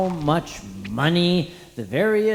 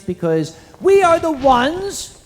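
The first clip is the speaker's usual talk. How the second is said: rallying speech; 5 dB louder than usual. Acted. Host rallying the convention crowd to give to charity, rousing. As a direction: rouse the room to give.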